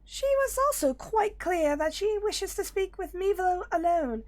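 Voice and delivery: very high, squeaky Loporrit; high rabbit voice. Acted. Rabbit trying to keep the hero behind, high squeaky voice. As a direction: push the pitch right up, sweet and fussy. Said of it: "I can't do this voice while sick".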